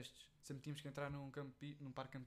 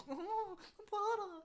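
squeaky high imitation of a scared friend; mock whiny protest; 6 dB louder than usual. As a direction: mimic him whimpering no, stop. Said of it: "eu estava-te a meter e tu". (imitação aguda e chorona de pânico)

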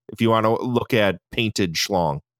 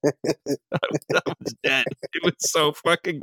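breathless laughing, trailing soft and slow; wheezing laugh over the words; 3 semitones higher, 4 dB quieter, slower than usual. Real, not acted. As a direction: still dying of laughter at the memory. Recalling the dummy scene, cracking up.

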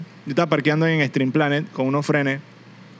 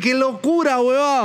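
amazed reaction; 6 semitones higher, slower than usual. Retold or imitated. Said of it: "yo dije".

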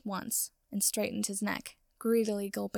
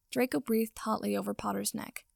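usual speaking voice

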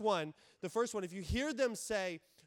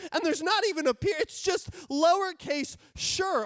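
high, loud, affronted overreaction; mock indignation; 8 semitones higher, 6 dB louder than usual. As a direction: act outraged at a tiny slight. Overreacting to a curt text reply.

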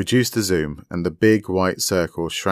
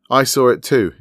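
higher, louder, with a broad grin; grinning broadly; 6 semitones higher, 8 dB louder than usual. A narrator's reading. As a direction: share the discovery through a grin. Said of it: "grinning broadly".

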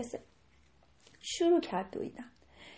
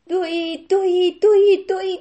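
louder and louder repeated ran; building excitement; 5 semitones higher, 10 dB louder than usual. A narrator's reading. Storyteller building the deer's run louder. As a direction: build each word louder.

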